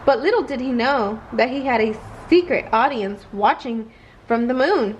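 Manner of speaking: plain reading voice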